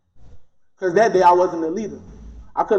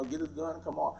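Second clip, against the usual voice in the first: hushed aside; 4 semitones lower, 16 dB quieter than usual. Retold or imitated.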